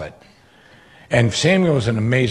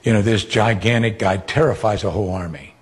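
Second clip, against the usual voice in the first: usual speaking voice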